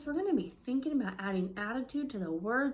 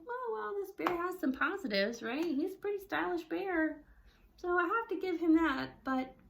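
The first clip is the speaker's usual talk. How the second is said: grudging mouse; 6 semitones higher than usual. Shown as a demonstration. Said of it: "He's kinda like".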